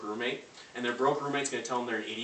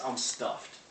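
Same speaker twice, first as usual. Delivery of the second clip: normal talking voice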